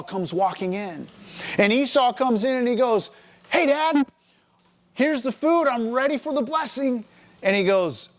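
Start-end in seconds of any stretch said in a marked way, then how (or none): 3.5-7.1 high-pitched, eager call to Dad; eager greeting. Acted. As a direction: pipe up eagerly, pitched well above usual. Esau arrives eagerly with the food.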